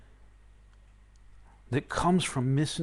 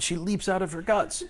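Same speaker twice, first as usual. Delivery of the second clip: ordinary delivery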